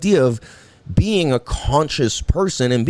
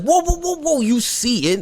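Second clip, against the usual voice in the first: alarmed parent; 12 semitones higher than usual. Retold or imitated. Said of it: "it's like".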